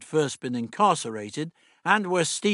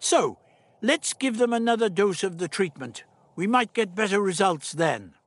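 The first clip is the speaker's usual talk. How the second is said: put-on old doctor voice; 8 semitones higher than usual.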